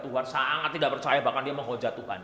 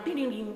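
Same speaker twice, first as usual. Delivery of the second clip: mock machine sound effect; 9 semitones higher than usual. Imitating Iron Man sounds.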